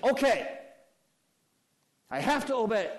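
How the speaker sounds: high, loud grudging whine; sulky tantrum. Acted. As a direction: grumble like a brat. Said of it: "like he is throwing a tantrum".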